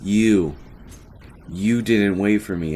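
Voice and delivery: mildly indignant, leaning on YOU; glaring accusation. Retold or imitated. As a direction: accuse a friend with mock indignation. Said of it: "I, I glare at Corbin".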